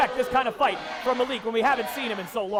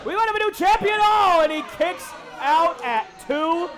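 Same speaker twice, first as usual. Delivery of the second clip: high, slowed near-fall call; excited commentary; 4 semitones higher than usual. Real, not acted. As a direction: ride the tension of the kick-out.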